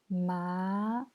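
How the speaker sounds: "ma" drawn out, gliding up; second tone. Shown as a demonstration. Teacher reviewing the four tones, "ma" rising. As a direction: let "ma" climb steadily in pitch. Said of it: "Deuxième ton".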